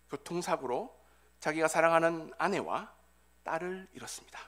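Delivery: ordinary talk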